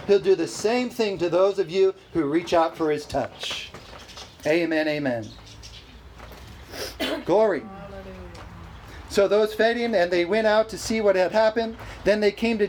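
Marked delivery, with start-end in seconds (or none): none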